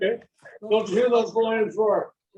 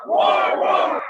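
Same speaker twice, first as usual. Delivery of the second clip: roar; 4 dB louder than usual. Acted. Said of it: "Ready, set, hit".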